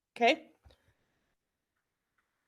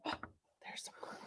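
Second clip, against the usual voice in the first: breathy gasp then a whispered discovery; surprised whisper; 18 dB quieter, slower than usual. Pretend. Teacher whispering in surprise to the class. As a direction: whisper it like a secret find. Teacher gasps at found word.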